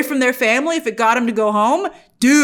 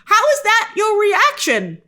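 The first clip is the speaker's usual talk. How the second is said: higher, slow disbelieving question; baffled viewer asking the screen; incredulous; 10 semitones higher, slower than usual. Retold or imitated. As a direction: stretch it out in disbelief. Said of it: "And you're like".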